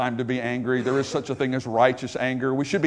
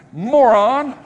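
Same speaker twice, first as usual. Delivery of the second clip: loud, high insult said with relish; enjoy hurling the mock-angry insult; playful mock anger; 7 semitones higher, 6 dB louder than usual. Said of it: "I just like saying that".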